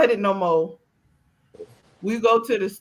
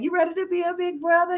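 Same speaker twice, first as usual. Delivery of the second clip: higher, fast, cheering; encouraging; 9 semitones higher, faster than usual. Retold or imitated. Mom cheering her son on about being a big brother, higher and fast. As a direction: pump the kid up. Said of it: "I'm doing the encouraging thing".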